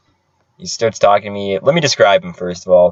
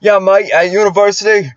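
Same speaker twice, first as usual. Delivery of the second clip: high, hammed-up Aussie impression; Australian accent; 10 semitones higher, 4 dB louder, slower than usual. Mimicking coworker going on about university girls, thick Australian accent. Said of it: "in a thick Australian accent".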